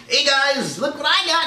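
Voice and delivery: high, teasing sing-song call; the zookeeper teasing the lions, high and playful. Pretend. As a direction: dangle the bait in a high taunting voice. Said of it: "a zookeeper going out dangling some meat".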